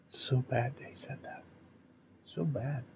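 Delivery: soft mocking whisper; hushed ironic tut-tut; sarcastic whisper. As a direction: whisper it with fake shock. Sarcastic aside.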